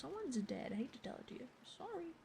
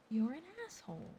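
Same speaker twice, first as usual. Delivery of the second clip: louder, indignant repeat of "You're an asshole."; louder accusation; 4 semitones higher, 4 dB louder than usual. Real, not acted. Reacting to Stapleton's plot, louder indignant repeat. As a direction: jab it at the villain harder.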